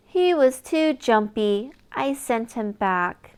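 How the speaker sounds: lively storybook reading with big reveals; reading to children. Acted. Reader sharing a lift-the-flap book with a child, animated. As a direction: read it like bedtime story time for a toddler. Reads picture book aloud.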